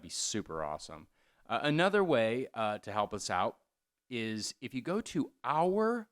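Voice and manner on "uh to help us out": ordinary talk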